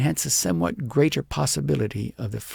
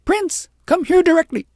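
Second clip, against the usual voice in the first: high, loud commanding yell; angry shout; 8 dB louder than usual. A narrator's reading. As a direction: order the dog back furiously.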